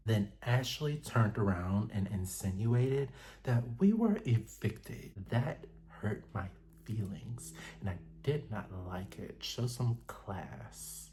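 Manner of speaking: hushed and offended, drawing out the last words; Angel voice. Retold or imitated. Recapping the show, still doing Angel's soft voice. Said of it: "I gotta put on my Angel voice".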